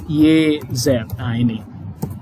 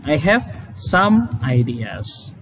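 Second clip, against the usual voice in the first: I have some ideas. said slowly and clearly; teacher reading an example sentence slowly; slow model sentence; 2 semitones lower, much slower than usual. Shown as a demonstration. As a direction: spell it out slowly for the class. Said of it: "Contoh misalnya".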